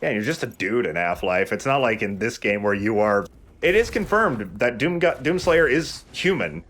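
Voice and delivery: usual speaking voice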